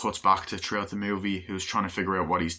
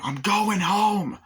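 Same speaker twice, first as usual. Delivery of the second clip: slow, high and louder; slow high drawn-out; 12 semitones higher, 5 dB louder, much slower than usual. Retold or imitated. Man abandoning his car in traffic, slow high drawn-out. Michael Douglas leaving traffic jam.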